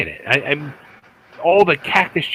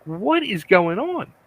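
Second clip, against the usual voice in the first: high, swooping baffled question; stunned disbelief; 6 semitones higher than usual. Retold or imitated. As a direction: ask it like you can't believe what you're watching. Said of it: "my mouth open".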